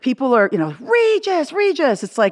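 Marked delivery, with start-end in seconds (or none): 0.8-2.0 high, sing-song greeting calls; calling out. Retold or imitated. Restaurant fans hailing Regis, high calls. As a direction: fans calling his name across a restaurant.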